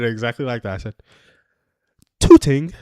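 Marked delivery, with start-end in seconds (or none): 2.2-2.7 loud, high-pitched put-on English accent. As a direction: belt it out in your best mock English accent. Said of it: "That's my best English accent".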